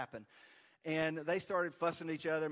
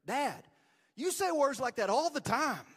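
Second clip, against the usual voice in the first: put-on higher, louder child cutting in; blurting kid; 11 semitones higher, 7 dB louder than usual. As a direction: pipe up like a cheeky son. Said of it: "He goes".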